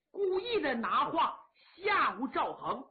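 usual speaking voice

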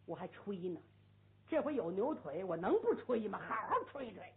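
ordinary delivery, nothing marked

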